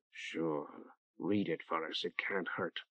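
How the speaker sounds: hushed, breathy whisper. A narrator's reading. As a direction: whisper it so others barely hear. Spider speaks quietly at a ritual.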